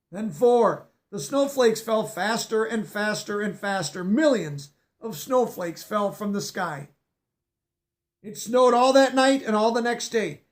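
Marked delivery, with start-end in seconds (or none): none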